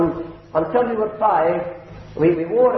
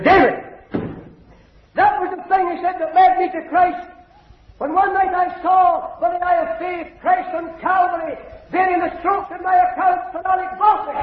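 fervent preaching; 13 semitones higher than usual. Acted. Preacher telling the congregation how Christ saved him, fervent high proclaiming. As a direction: proclaim it with full fervour.

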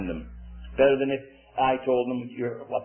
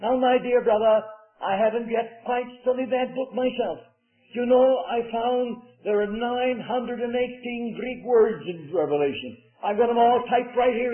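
quaint English voice; 9 semitones higher than usual. Retold or imitated. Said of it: "he said in his quaint English vo-, voice".

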